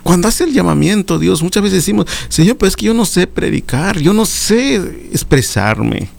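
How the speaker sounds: high, impassioned sermon delivery; fervent preaching. Acted. Preacher urging brothers to answer God's call, impassioned high preaching. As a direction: lift the congregation, preach with fervour. Preacher exhorting at full force.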